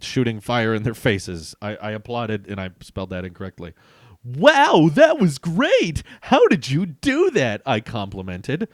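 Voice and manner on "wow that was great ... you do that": high, loud gushing praise; excited hero line. Acted. Hero cheering the freed prisoners, high and loud. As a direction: gush at the villains like a starstruck fan. Reading own comic hero lines.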